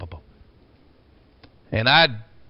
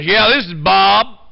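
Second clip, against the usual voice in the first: slow, louder imitation of the caller; imitating Bob; 4 semitones higher, 7 dB louder, slower than usual. Impersonating caller Bob on the phone, slow. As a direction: drag it out like the caller did. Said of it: "I'm doing him exactly the same".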